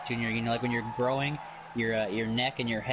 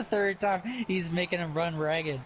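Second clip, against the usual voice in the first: ordinary talk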